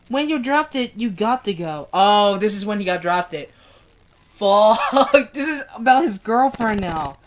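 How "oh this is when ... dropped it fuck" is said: plain reading voice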